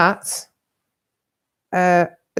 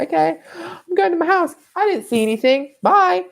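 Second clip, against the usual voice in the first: mock nervous exit; 12 semitones higher than usual. Fleeing after stabbing scene.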